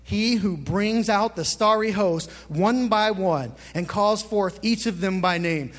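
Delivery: ordinary talk, nothing marked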